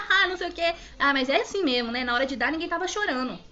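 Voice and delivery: imitating a jeering laugh, high and loud; retold taunting mockery; mocking. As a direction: sneer it like the scoffing anaesthetist, easing down from a high start. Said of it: "começou a debochar".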